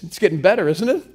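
normal talking voice

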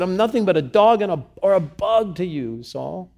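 high, quick, acted outburst; indignant protest. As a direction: belittle yourself in exasperation. David protesting to Saul.

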